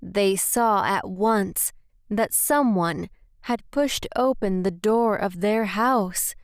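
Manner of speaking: usual speaking voice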